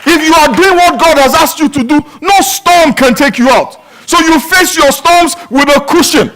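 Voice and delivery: loud, high preaching, driving every phrase; preaching at full force. Acted. Pastor preaching to the church, loud and driving. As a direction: preach it out over the congregation.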